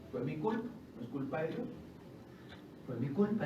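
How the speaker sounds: usual speaking voice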